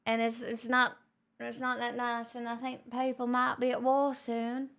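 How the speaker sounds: lightly put-on posh British lady accent; a hint of posh British accent, playful; mock posh British accent. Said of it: "do you like my mix of accents".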